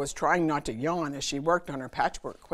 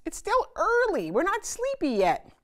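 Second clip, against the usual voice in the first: protesting cubs; 5 dB louder than usual. Acted.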